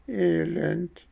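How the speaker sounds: very high, thin echo of Elend; cat's echo. Acted. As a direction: repeat it like a whimpering cat. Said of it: "echote die Katze".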